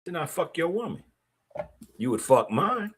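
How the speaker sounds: ordinary talk, nothing marked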